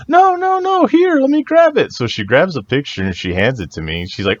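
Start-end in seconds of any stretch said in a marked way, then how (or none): 0.1-1.9 very high imitation of a helpful lady; retold fussing in a high voice; mock eager insistence. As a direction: insist on helping, pitched way up.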